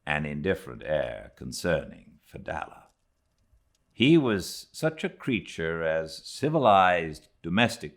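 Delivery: measured, unhurried literary reading voice; slow, deliberate audiobook narration of an old sea tale; story narration. A narrator's reading. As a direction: read it like a fireside storyteller. Reads Moby Dick aloud.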